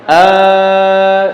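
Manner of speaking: a drawn-out Eh from the throat; voiced sound demo; demo vowel sound. Shown as a demonstration. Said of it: "itu suara namanya".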